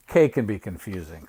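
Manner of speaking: usual speaking voice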